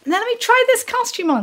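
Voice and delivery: very high showbiz diva voice; rally the girls like a chorus leader; theatrical, high-pitched mimicry. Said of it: "It was these high kicks and like".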